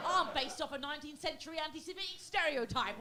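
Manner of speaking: high, loud music-hall Cockney; stage Cockney. Shown as a demonstration. Stage Cockney character explaining where it comes from, loud mock Cockney. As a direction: proclaim it in hammed-up Cockney. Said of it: "more like stage Cockney".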